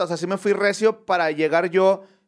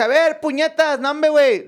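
high-pitched scolding call; annoyed boss; 7 semitones higher, 4 dB louder than usual. Retold or imitated. Master builder calling down to his helper, annoyed. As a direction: call down in exasperation. Maestro regaña al chalán.

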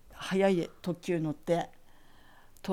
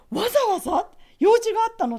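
much higher and louder, amazed; astonished lady; 9 dB louder, faster than usual. Retold or imitated. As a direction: squeal in disbelief as the refined teacher. Teacher amazed at long trip.

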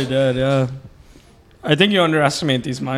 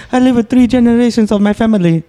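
exaggerated high-pitched impression of a comic; Sai; 6 semitones higher than usual. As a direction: imitate his way of talking, pitched well up. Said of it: "That's such a sigh way of saying".

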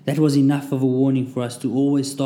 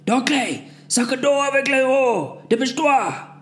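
shouted orders; 13 semitones higher than usual. A narrator's reading. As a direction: bark orders at the mob. Leaders yelling orders.

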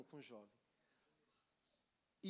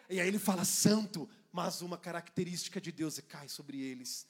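much louder, building fervor; preaching at full force; 13 dB louder than usual. Acted. Preacher climaxing on God's endless revelation.

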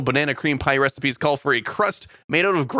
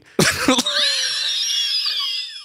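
high shrieking burst of laughter. Real, not acted. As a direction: let the laugh explode up high.

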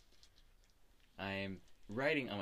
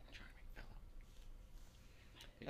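slow, soft whisper; whispered aside; 11 dB quieter, much slower than usual. Acted. Character comments privately on the barkeeper, whispering. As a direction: whisper it slowly under your breath. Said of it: "I, I whispered".

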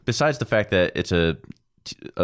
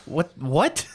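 high, incredulous what; a hammed-up double what; mock surprise; 9 semitones higher than usual. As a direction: react in mock disbelief. Mock reaction to absurd plot.

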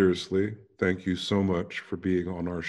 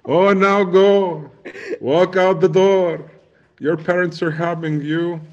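much higher, louder, slow mock command; mock shooing; 10 semitones higher, 9 dB louder than usual. Host shoos the guest back to her children in a high, loud mock voice. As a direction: shoo her off like a pantomime dame.

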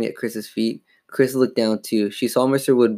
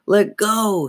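high-pitched angry cry, lightly growled; mild vicious growl; 9 semitones higher than usual. A narrator's reading. Dummy pinned under her foot, mildly growling. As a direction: demand release, a touch vicious. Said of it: "He growled viciously".

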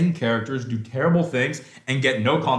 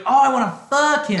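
higher, put-on swooning girl; mock lovestruck girl; 8 semitones higher, 3 dB louder than usual. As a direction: mimic a smitten girl with a sneer. Mocking the tall girl's crush.

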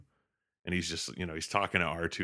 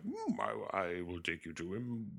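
Yoda; 8 dB quieter than usual. Retold or imitated. Yoda putting off Luke's questions, soft creature voice. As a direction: croak it softly like a little puppet sage. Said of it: "And he's like".

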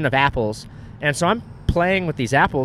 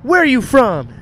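high, loud, mock camera hype; overexcited interviewer parody; imitated hype woman; 9 semitones higher, 7 dB louder, slower than usual. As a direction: call out like a pushy promo host. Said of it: "this chick's like".

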